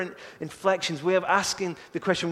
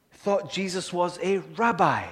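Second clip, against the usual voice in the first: normal talking voice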